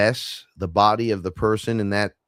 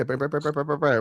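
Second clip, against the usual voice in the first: higher rapid run of brr noises; mimicked brr babble; 6 semitones higher, faster than usual. Imitating strangers' chatter to a listener, high brr babble. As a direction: babble a string of brrs, pitched up.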